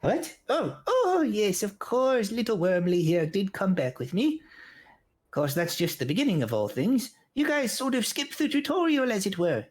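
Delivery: high startled then pleased gnome; surprised gnome elder. Acted. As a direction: brighten up suddenly. Gnome answers player.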